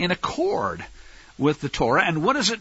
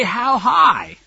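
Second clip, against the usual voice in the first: mock obedient slave; 6 semitones higher, 5 dB louder than usual. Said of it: "good little slaves don't disobey".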